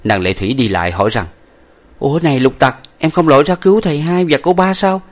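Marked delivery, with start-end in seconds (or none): 2.0-5.0 high-voiced puzzled young woman; surprised questioning. A narrator's reading. Young lady asking why the servant stayed ashore, surprised. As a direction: question him with raised pitch.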